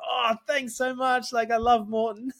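high, drawn-out imitation of a thrilled kid; buzzing. Imitating the kid handed a bar and gel, buzzing. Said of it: "he was buzzing".

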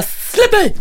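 high, sharp cry of annoyance; child's protest. Retold or imitated. Son angrily tells mom to let go, high and sharp. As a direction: shove the words out like an irritated kid. Son pushing mother away.

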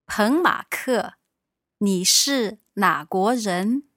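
normal talking voice

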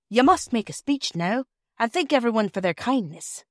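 ordinary delivery, nothing marked

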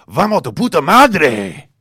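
a hammed-up Spanish rallying yell; fierce shout. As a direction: roar it out to rally him. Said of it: "closed by saying".

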